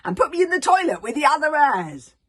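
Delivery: high, loud bungled order; poor Dragonese. A narrator's reading. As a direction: bark it clumsily at the dragon. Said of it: "in his extremely poor Dragonese".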